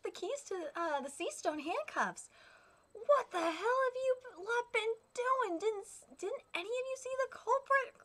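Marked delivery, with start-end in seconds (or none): 2.9-7.9 irritated prison master scolding; annoyed boss. Acted. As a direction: snap at useless guards. Angry boss yelling at guards.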